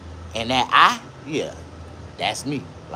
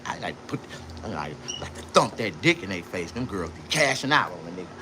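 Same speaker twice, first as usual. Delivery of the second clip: ordinary talk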